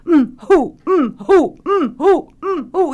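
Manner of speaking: high, loud repeated "mm, hoo" sobs; comic sobbing. Retold or imitated. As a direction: keep the fake sob going steadily. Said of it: "'mm, who,' and keep saying it".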